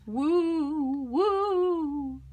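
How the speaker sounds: eerie woo of the wind; wind howl. A narrator's reading. Wind sound in story.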